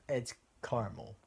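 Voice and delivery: "caramel" as two-syllable CAR-mul; Californian pronunciation. Retold or imitated. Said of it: "I'm like".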